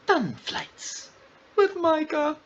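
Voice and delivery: high, sung-out playful title announcement; show title call. Pretend. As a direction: sing out the show's name. Announcing his show's name.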